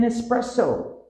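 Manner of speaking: espresso as es-PRESS-o; no X sound. Shown as a demonstration. Teacher correcting learners, espresso without X. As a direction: keep a clean s at the start of espresso. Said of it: "There's no X sound".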